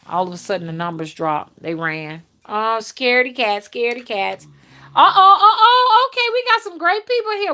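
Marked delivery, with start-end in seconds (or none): 4.9-7.5 high, bright, laughing delight; excited laughing. Real, not acted. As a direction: squeal it with glee. Delighted at more thumbs up.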